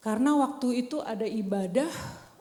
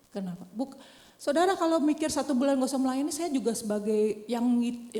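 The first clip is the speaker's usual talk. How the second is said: ordinary talk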